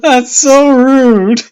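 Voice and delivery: high, loud, drawn-out amused protest; laughing disbelief. Real, not acted. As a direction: cry it out through real laughter. Reader cracks up at text.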